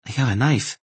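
high, guarded warning; sharp warning. A narrator's reading. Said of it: "the unseen woman warned sharply".

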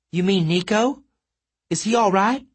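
high, choked worried question; strangled alarm. A narrator's reading. Hazel asks after her brother Nico, high and choked. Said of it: "Hazel made a strangled sound".

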